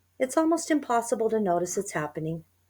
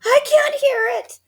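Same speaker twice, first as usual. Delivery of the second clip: very high, very loud terror; panicked old wife; high loud terrified cry; frightened cry; 10 dB louder than usual. A narrator's reading. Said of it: "cried Missus Twit".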